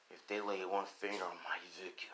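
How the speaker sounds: soft, menacing thought to oneself; inner thought. Acted. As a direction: think it softly, as a private threat. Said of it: "She's thinking in her head".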